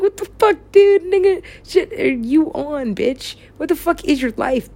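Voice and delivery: cuss him out in a shrill mock rant; hammed-up outraged tirade. Cussing out [name], acted rant.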